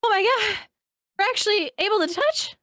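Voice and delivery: high-pitched, over-the-top disbelief; mock amazement. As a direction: play a dater astonished by real life. Playing socially awkward dater amazed.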